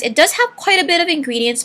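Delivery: ordinary talk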